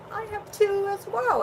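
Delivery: high-pitched, delighted "me too"; excited retold reply. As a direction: re-enact finding common ground, pitch jumping up. Excitedly discovering shared pets with friend.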